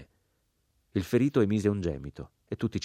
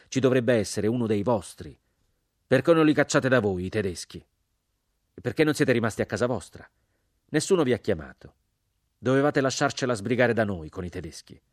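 louder, higher, fists clenched in the voice; angry outburst; 4 semitones higher, 6 dB louder than usual. Acted. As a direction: let the resentment boil over. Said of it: "stringendo i pugni".

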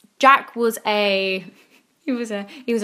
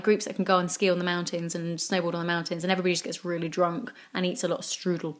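ordinary talk

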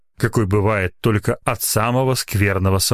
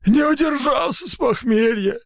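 much higher, wailing excuse; pleading shout. A narrator's reading. Guilty lab assistant wailing his excuse to the doctor. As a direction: cry out the excuse pitifully.

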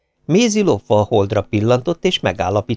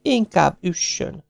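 sighing; 9 semitones higher, 3 dB quieter, slower than usual. A narrator's reading. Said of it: "Maisie felsóhajtott".

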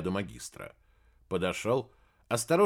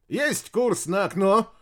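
loud, slow bravado reply; brisk shout; 9 semitones higher, 6 dB louder, slower than usual. Acted. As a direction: bark it back bravely. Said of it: "браво отзывается рулевой".

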